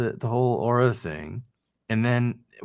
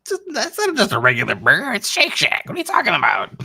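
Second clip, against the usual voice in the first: much higher, louder indignant protest; indignant outburst; 13 semitones higher, 5 dB louder, faster than usual. Retold or imitated. Husband to wife about the burger, indignant high protest. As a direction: defend the burger with mock outrage. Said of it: "And I'm like".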